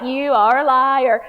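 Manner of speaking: playful accusing "You are a liar"; mock accusation. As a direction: call their bluff, half laughing. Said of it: "I'll be like".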